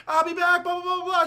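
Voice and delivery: high, loud mock yell; retold yell. Heroine yells to her editor on the way out, high mock yell. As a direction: call it back over your shoulder. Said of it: "She yells at her editor".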